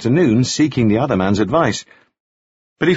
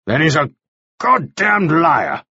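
slow, higher curse on the liar; angry accusation; 6 semitones higher, slower than usual. A narrator's reading. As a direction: spit out the accusation, dragging it out. Armed interrogator accuses goldsmith of lying.

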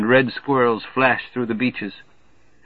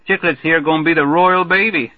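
put-on high, loud, hearty good-ol'-boy; brash Major Marvey; 6 semitones higher, 5 dB louder than usual. Marvey hollering a greeting to the newcomer, brash and hearty. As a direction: boom the welcome like a glad-hander.

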